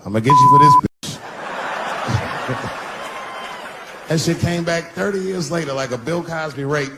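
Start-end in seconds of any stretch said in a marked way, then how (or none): 0.1-0.9 loud, low threatening vow; mock threat. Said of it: "just looking at her like". 0.9-4.0 high breathy laugh. Real, not acted. Laughs at own joke.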